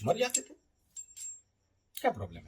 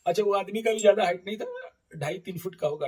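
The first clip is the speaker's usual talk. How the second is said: high, loud start breaking into laughter; real amused laughing; 6 semitones higher, 6 dB louder than usual. Real, not acted. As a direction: laugh while describing someone comical. Laughs describing the tiny baba. (हँसी के साथ बोलना)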